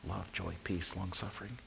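very soft, low recital; acted thought, barely voiced; silent mental review. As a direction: murmur the list to yourself. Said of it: "while you're thinking all this".

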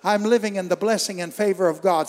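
loud, fervent call-and-response; loud declaration. Real, not acted. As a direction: shout it with them, driving. Preacher leading loud declaration.

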